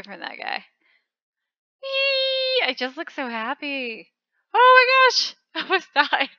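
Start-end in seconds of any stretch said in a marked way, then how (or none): 1.8-2.7 high delighted squeal; gleeful whee. Real, not acted. 4.5-6.3 relieved laugh. Real, not acted.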